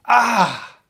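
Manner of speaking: sharp high yelp of pain; a loud stung cry, real. Real, not acted. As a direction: yelp like you've been hit. Stung by the AI's words.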